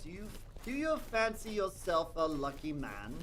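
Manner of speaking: slow, mock-grand old English lilt, rising high; terrible put-on accent. Streamer asking himself in a bad knightly accent. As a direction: ham up a knightly olde English voice. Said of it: "I don't know why we're doing that accent".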